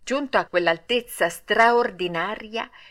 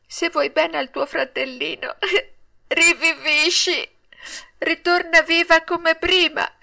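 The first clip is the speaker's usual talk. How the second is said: high-pitched wailing through sobs; grief-stricken, high sobbing plea; tearful lament; 7 semitones higher than usual. A narrator's reading. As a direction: act out a puppet child crying and pleading, breaking into sobs. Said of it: "E piangendo diceva".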